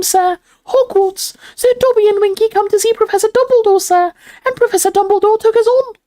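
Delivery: very high, loud elf squeak; excited house elf. A narrator's reading. Dobby tells of being hired, squeaky elf voice. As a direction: pipe it out like a proud little elf.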